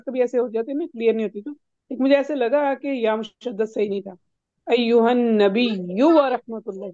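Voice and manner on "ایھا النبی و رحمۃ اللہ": very slow ایھا النبی with a doubled ی in النبی; slow teaching recitation of ایھا النبی; careful tajweed demo. Shown as a demonstration. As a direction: model النبی slowly with a stressed, doubled ya. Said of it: "بولیے ذرا دوبارہ".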